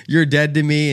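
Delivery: retold rage. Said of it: "seeing red".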